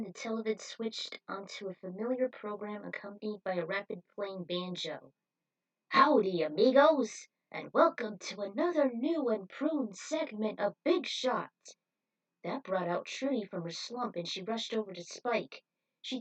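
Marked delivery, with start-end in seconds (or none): 5.9-11.5 booming folksy show host greeting, much louder. Acted. Cowboy host greets viewers on the televid, booming and loud. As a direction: belt it out like a cowboy TV presenter.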